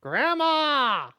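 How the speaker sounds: shouting Grandma high and loud; calling out. Real, not acted. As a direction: call out to her sharply. Yelling at grandma in game.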